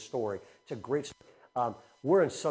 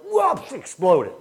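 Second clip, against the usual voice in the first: higher, louder, slow, drawn-out exclamation; animated storyteller burst; excited burst; 5 semitones higher, 10 dB louder, slower than usual. Retold or imitated. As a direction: paint the class exploding. Class uproar retold.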